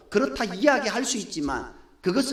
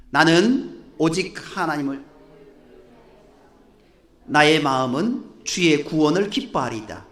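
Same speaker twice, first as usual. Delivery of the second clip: scripture reading; 2 semitones lower, 4 dB louder than usual. Acted.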